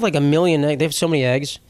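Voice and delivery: ordinary talk, nothing marked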